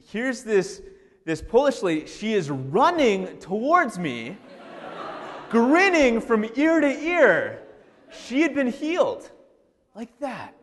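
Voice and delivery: overjoyed, can hardly contain it; excited, laughing. Real, not acted. Joyful retelling of healing.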